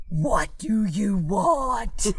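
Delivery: very slow, drawn-out sinister voice; slow demonic goat, hammed up; devil goat voice. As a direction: stretch each word like a tempting devil. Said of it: "then you just hear this voice".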